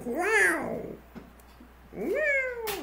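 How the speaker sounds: very high squeaky startled wow; imitated startled yelp. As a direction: shriek it like a startled man. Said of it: "Like that".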